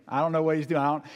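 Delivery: usual speaking voice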